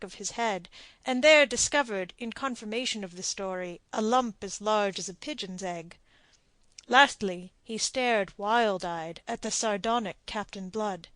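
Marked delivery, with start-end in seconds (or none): none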